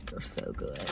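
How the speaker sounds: breathy whisper of delight. Real, not acted. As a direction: whisper it like a secret treat. Praising her favorite cough drops.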